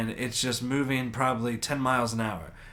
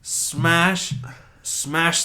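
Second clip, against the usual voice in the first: punchy smash; 5 semitones higher, 6 dB louder than usual. Retold or imitated. Retelling the crash impact.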